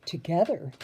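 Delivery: ordinary delivery, nothing marked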